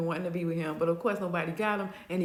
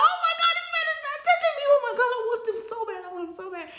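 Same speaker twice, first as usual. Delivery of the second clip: high, loud, rushed squeal; hammed-up flipping out, fast and high; freaking out; 5 dB louder, faster than usual. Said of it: "she freaking out and flipping out".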